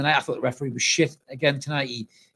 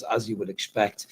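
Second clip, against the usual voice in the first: usual speaking voice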